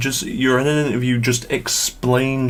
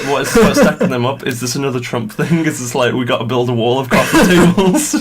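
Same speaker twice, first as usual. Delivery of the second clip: louder, laughing disbelief; baffled rant breaking into laughter; incredulous, laughing; 5 dB louder than usual. Real, not acted. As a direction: crack up while asking in disbelief.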